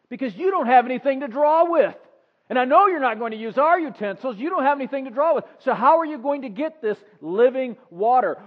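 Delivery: loud, high, scornful retort; snarky. Acted. Samaritan woman mocking the stranger at the well, loud and snarky. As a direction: snap back at Jesus with scorn. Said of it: "she gets a little bit snarky with Jesus".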